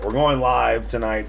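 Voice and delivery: ordinary delivery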